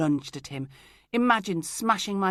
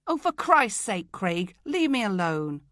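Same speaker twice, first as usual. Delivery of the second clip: exasperated outburst; 3 semitones higher, 7 dB louder than usual. Acted. Telling Craig to leave her alone, exasperated and louder. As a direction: snap at him, fed up.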